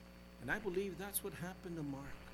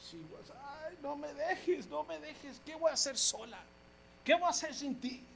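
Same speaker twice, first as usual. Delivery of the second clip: widow's lament; 6 semitones higher than usual. Retold or imitated. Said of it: "And she said".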